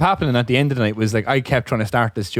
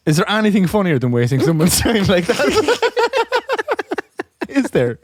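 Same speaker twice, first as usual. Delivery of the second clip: higher voice breaking into laughter; delighted, giggly question; laughing; 6 semitones higher than usual. Real, not acted. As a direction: ask it while cracking up at your own prank. Teller still cracking up after joke.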